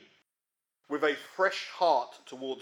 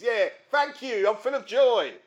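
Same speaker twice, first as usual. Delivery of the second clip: mock sarcastic joy; 7 semitones higher, 6 dB louder than usual. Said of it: "meet me down the road and say".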